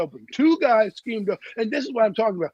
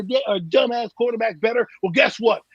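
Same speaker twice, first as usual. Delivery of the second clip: usual speaking voice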